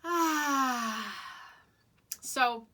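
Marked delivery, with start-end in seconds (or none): 0.1-1.1 demo sigh. Shown as a demonstration. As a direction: open breathy sigh out through the mouth. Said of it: "like this".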